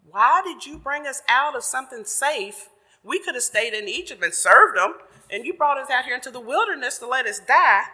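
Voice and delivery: mock wail at God for bringing them out; crying out to God. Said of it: "they're crying out to God like".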